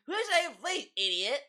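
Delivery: high, mocking whine of DSP. Mocking DSP's complaint to viewers, high mocking whine. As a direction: sneer it up high like a sulky streamer. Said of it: "And he's like".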